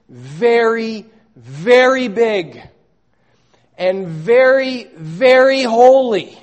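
slow, loud, stretched very and holy; heavy emphasis. Real, not acted. Preacher pressing how big and holy God is, slow and loud. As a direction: lean hard on each very. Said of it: "I can't emphasize this enough".

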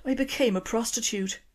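Irish daughter confessing to her dad. Acted. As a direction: own up in a small Irish voice. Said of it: "excuse the accent".

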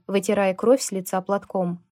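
plain reading voice

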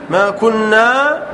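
slow, drawn-out reading of the verse; slow recitation. Shown as a demonstration. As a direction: draw it out to show the missing letter. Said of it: "لكن بدون، بدون ياء". (تلاوة بطيئة ممدودة)

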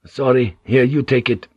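ordinary delivery, nothing marked

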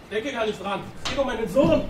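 worried father, pitch climbing high; desperate refusal. Acted. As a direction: push back, frantic for his son. Father refuses, fears for son.

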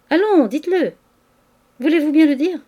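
high, loud, teasing demand; playful insistence. A narrator's reading. Lodger teasing his landlady in his arms, playful insistence.

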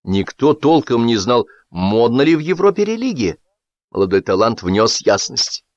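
usual speaking voice

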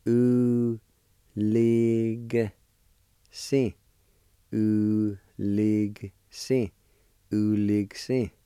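U, lig, si said slowly, each part separate; syllable by syllable. Shown as a demonstration. Teacher modelling the word for gut. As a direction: sound out U, lig, si. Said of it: "like this".

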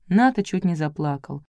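ordinary delivery

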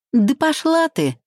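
high, sharp snarl of a retort; snapping back. Acted. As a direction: bite back in fury. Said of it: "огрызнулась Маринка".

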